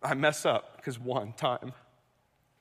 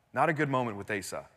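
normal talking voice

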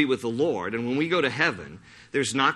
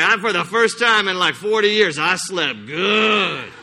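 mock widow; 5 semitones higher, 6 dB louder than usual. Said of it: "she was like".

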